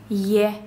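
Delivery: front ye on «е». Shown as a demonstration. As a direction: say «е» as an isolated ye for comparison. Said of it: "Сравните".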